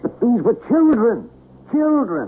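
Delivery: put-on a high, grieving cry of children; anguished cry. Anguished outburst over dead children.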